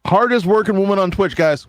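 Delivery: loud, high hype call; big hype announcement; excited shout. Real, not acted. Hyping up the co-host.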